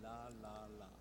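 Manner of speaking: put-on very soft "La, la, la" for the child to copy; quiet demo syllables. Shown as a demonstration. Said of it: "Try it once more".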